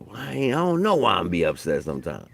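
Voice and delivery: normal talking voice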